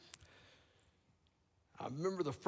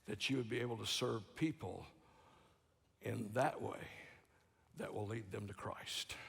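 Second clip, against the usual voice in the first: hushed sermon; 7 semitones lower, 9 dB quieter than usual. Real, not acted. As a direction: draw them in, barely above a breath. Sermon drops to hushed intimacy.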